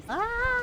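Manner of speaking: high-pitched playful yelp of alarm; mock scream. Player facing so many enemies, playful scream. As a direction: let out a high, drawn-out pretend scream.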